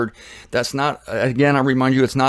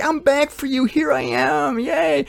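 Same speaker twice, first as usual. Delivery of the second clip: high, chirpy returning savior; cheerful Jesus voice; 13 semitones higher, slower than usual. Pretend. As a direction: bounce in high and happy, arms wide.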